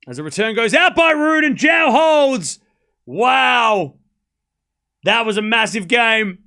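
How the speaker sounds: loud, rising play-by-play as the point ends; excited commentary. Real, not acted. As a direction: call it like a live commentator. Live play-by-play calling.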